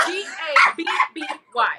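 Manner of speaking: each letter of "G A B B Y" punched apart, high and loud; emphatic spelling. Real, not acted. Correcting someone who misspelled the name, emphatic spelling.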